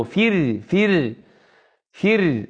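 repeated "فر" as fir, thin held ر; isolated syllable "فر", r unvelarized; light ra. Shown as a demonstration. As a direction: drill "فر" with clear i and light r. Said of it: "الراء مكسورة".

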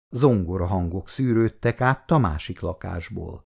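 ordinary delivery, nothing marked

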